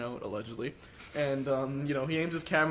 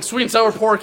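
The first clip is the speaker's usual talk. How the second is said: high, loud imitated blurt; blurted echo order; 6 semitones higher, 9 dB louder, slower than usual. Shaken friend ordering from waiter, loud blurt. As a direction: echo the order far too loudly.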